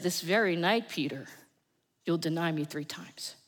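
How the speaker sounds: low, soft and grave prophecy; solemn quiet. Acted. Jesus quietly foretelling Peter's denial, low and solemn.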